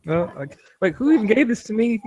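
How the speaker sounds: 짜증 섞인 높은 목소리; exasperated high voice. Acted. Kumba exasperated.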